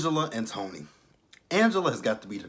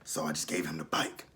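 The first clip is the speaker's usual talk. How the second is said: low, soft, almost whispered naive woman; mock her as a soft-spoken, clueless woman; Angela voice; 6 semitones lower, 7 dB quieter than usual. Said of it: "And Angela was like".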